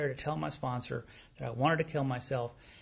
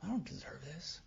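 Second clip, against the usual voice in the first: much softer, half-whispered; hushed repeat; 3 semitones higher, 9 dB quieter than usual. Retold or imitated. Speaker retelling his own protest, hushed. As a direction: say it under the breath.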